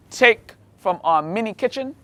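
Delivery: normal talking voice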